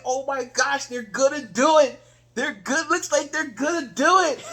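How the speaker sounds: high, hopeful fan excitement; worked up. Retold or imitated. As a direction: cheer them on in disbelief. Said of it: "I'm getting worked up".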